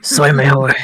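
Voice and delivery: fuller-voiced self-affirmation; firmer repeat. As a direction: say it up, out of the mock whisper.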